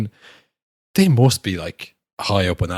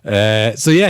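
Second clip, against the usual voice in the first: ordinary talk, nothing marked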